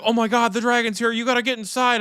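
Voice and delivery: high, fast panicky friend; mock his frantic warning; panicked Michael. Mimicking her scared teammate's panic. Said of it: "Michael's like".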